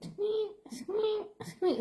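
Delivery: high squeaky squeak, half laughing; door squeak imitation. Describing a squeaky door, imitates it high with a laugh. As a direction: mimic a creaky hinge, way up high.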